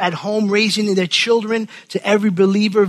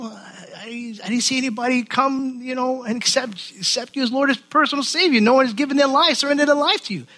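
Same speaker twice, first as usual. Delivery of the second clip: higher, anxious pleading to the Lord; mock panic; 6 semitones higher than usual. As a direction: play Paul flustered and worried. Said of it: "Paul's probably freaking out".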